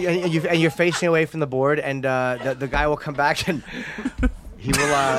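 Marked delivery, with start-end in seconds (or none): 3.7-4.5 breathy giggle. Real, not acted. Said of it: "breathy laugh".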